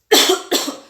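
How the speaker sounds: tos seca de demostración; tos fingida. Shown as a demonstration. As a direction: tose otra vez para mostrar. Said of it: "hacemos así". (tos fingida)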